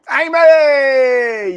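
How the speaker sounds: long, high, loud holler; high drawn-out shout to someone off-screen; calling out. Pretend. Calls out to Amy off-screen.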